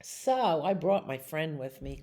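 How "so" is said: plain reading voice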